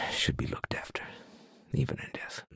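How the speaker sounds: hushed, unvoiced whisper. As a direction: breathe the words like a secret vow. Grave vow about family.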